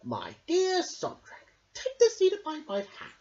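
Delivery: high, sweet royal welcome; fawning king. A narrator's reading. King welcoming Sondrak, high and fawning. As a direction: flatter the guest. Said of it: "said the king".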